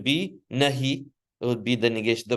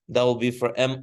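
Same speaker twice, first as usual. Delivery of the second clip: ordinary talk, nothing marked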